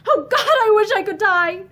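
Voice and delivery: high, loud, crying wail; despairing cry. A narrator's reading. Pansy alone after Lynn leaves, crying out in despair.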